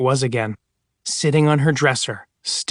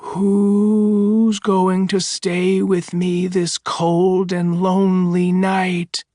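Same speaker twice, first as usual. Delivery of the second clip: very slow, higher, drawn-out moan; moaning ghost voice; 5 semitones higher, much slower than usual. Acted. The voice in the woods calls to the woman, slow moan. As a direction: moan it like a lost spirit far off.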